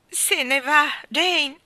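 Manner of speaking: high, loud cry of distress; tearful shout. A narrator's reading. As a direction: cry out in despair to his sister. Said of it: "gridò Michael piangendo".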